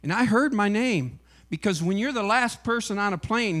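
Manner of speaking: usual speaking voice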